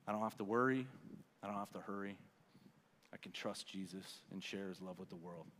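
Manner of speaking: hushed, low recital of a creed; solemn recitation. Real, not acted.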